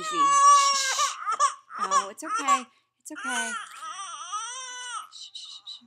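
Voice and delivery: calm the little one gently; soothing hush. Real, not acted. Teacher hushing a crying child in the room, tender and soothing.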